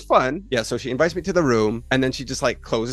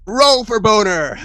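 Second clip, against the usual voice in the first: loud, high, drawn-out announcement; hammed-up dice-roll command; mock commanding call; 8 semitones higher, 5 dB louder, slower than usual. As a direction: imitate a game master calling for a roll, slow and loud. Quotes game master's outrageous command.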